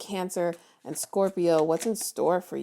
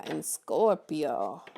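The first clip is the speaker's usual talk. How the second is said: drawn out; much slower than usual. Real, not acted. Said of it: "I don't know why I said it like that".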